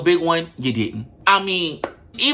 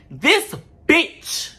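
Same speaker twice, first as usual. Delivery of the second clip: this BITCH, pitched high and hit hard; stress on bitch; 9 semitones higher than usual. Shown as a demonstration. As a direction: lean all your weight on bitch. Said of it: "emphasis on bitch".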